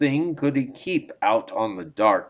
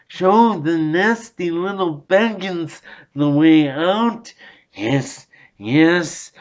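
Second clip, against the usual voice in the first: higher, strange wheedling creature voice talking to itself; Gollum voice; 5 semitones higher than usual. A narrator's reading. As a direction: play a sly cave creature. Gollum's lines in dialogue.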